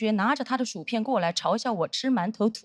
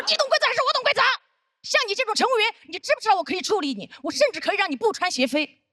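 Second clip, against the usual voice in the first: mock berating; 9 semitones higher, faster than usual. Senior threatening junior flight attendant, hammed-up scolding.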